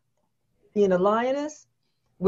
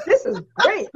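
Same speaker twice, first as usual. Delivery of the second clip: higher, laughing as she says it; laughing kid; 8 semitones higher, 4 dB louder than usual. Retold or imitated. Little sisters praising mom's ketchup omelets, laughing. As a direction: laugh through the kids' delight. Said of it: "my sister and I were like".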